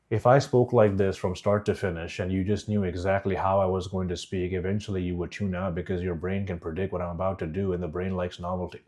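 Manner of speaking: low, soft, unvaried drone; boring monotone. Shown as a demonstration. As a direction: drone on without any variation. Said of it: "Can you see how bored you are already".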